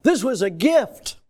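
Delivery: high pulpit delivery, stretching the last word; full-force preaching. Real, not acted. Preacher warning the church about despising a gift, full-force preaching. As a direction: preach it hard and drag out the final word.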